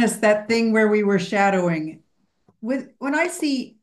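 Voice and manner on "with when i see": usual speaking voice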